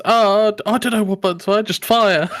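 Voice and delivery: goofy gun-giver brushing off the tutorial; careless tutorial guy. Retold or imitated. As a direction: shrug it off like a lazy instructor. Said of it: "he just goes".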